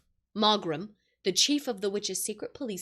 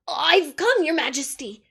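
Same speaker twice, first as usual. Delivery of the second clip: eager; 6 semitones higher, 7 dB louder than usual. A narrator's reading. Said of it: "rushing eagerly forward".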